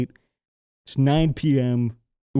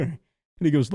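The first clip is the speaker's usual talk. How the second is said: ordinary talk, nothing marked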